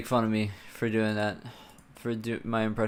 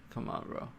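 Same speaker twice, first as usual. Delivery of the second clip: whispered, breathy plea; 11 dB quieter than usual. Real, not acted. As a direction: drop to a whisper, half-begging. Whispered plea after repeat.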